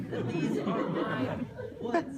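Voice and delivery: loud, high "these are my woods"; loud insistence. Acted. Wolf insists to the courtroom on his territory, loud and emphatic.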